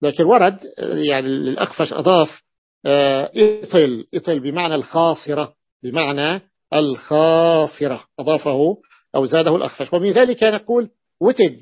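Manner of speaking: ordinary talk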